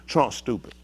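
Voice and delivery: ordinary delivery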